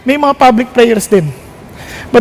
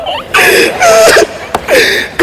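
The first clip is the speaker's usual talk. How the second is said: high sobbing laugh; a real teary, breaking laugh; crying laugh. Real, not acted. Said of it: "Talagang iiyak na ako". (umiiyak na tawa)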